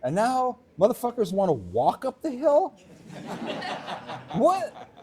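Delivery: high, loud mock-outraged old-timer rant. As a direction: rant like an indignant snowboard veteran. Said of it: "Time for a rant".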